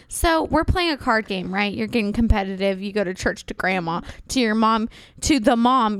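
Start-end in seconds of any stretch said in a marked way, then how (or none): none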